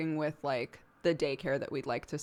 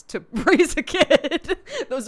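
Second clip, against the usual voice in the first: louder, higher and laughing; 10 semitones higher, 10 dB louder, slower than usual. Retold or imitated. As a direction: laugh him out of his worry. Reassuring husband, laughing.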